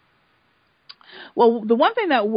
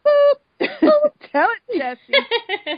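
cheering; 12 semitones higher, 5 dB louder, much slower than usual. Retold or imitated. Cheering Jesse on while watching.

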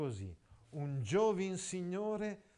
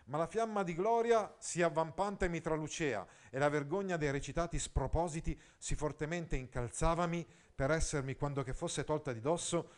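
reading aloud; 2 semitones higher, 5 dB louder than usual. Acted. Reads passage.

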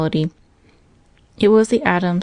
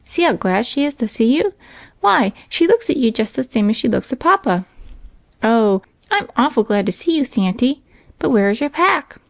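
put-on high, lilting little boy voice; excited child; 6 semitones higher than usual. A narrator's reading. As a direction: gush happily in a child's high pitch.

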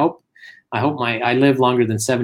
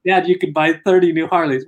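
higher-pitched, amused mock exasperation; exasperated sons; 6 semitones higher, 3 dB louder than usual. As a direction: retell the sons' incredulous protest to dad. Sons exasperated with injured dad.

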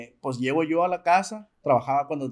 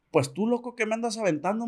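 papá enojado; 6 semitones higher than usual. Retold or imitated. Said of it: "me dijo bien enojado".

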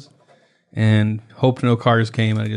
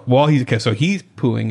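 usual speaking voice

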